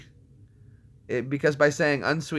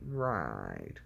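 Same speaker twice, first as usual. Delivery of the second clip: very low, soft, falling drawl; deep comic echo; 12 semitones lower, 10 dB quieter than usual. Pretend. Streamer echoing own question, deep slow comic answer. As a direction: drop far down and stretch it out.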